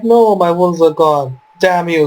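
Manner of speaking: ordinary delivery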